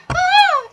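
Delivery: short, very high, loud mock scream; piercing pretend fright scream; imitated scream. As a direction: scream like a startled movie audience. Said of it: "Everyone's like".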